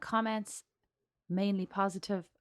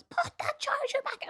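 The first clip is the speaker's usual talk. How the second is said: mock shriek; slower than usual. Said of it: "I actually shrieked at the top of my lungs".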